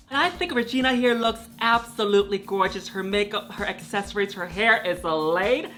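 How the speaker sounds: ordinary talk, nothing marked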